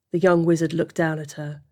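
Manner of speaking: ordinary delivery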